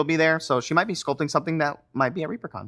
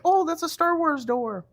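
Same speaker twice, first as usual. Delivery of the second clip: put-on excited kid voice; slower than usual. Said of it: "earlier the kids were like".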